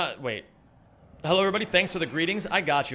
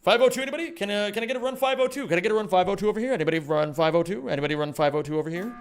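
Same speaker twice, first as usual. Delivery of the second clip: fast rattling auctioneer call, higher; mock auctioneer chant, rapid and rising; 3 semitones higher, much faster than usual. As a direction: rattle it off like an auctioneer taking bids. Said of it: "gone into auction mode".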